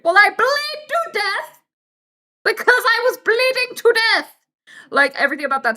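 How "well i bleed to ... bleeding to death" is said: much higher and louder, slow; exasperated outburst. Real, not acted. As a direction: burst out in disbelief. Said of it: "exasperated outburst".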